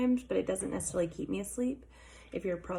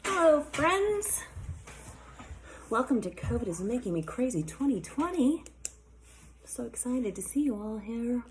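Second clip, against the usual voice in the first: higher, louder mock news anchor; newscaster voice; 6 semitones higher, 4 dB louder than usual. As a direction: greet viewers like a TV host. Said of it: "This is my fake newscaster voice".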